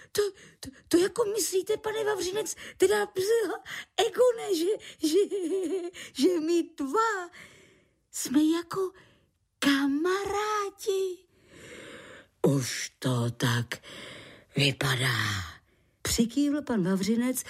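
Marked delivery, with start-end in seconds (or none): 0.1-11.2 very high, stammering little creature; flustered disbelief. Acted. Lichožrout checks they are really friends, flustered amazement. 12.4-15.5 low, slow, fading to a near whisper. Acted. Man confirms friendship.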